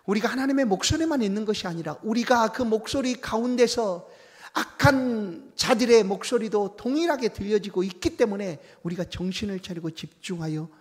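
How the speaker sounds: high, loud preaching cry; fervent preaching. Acted. Pastor exhorting the congregation to listen, fervent and loud. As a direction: lift the warning to the whole church.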